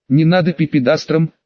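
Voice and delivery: ordinary delivery, nothing marked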